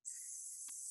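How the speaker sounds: long soft hiss on "S-"; voiceless S. Shown as a demonstration. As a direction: hold "S-" with the voice off. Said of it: "this one has the voice off".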